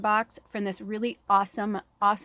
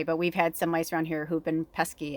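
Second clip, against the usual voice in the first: usual speaking voice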